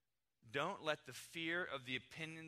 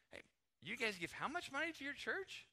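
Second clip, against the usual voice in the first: high-pitched, disbelieving query; astonished, pitch leaping up; incredulous question; 11 semitones higher than usual. Acted. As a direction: play a friend amazed at the amount. Acted nosy friend asking about giving.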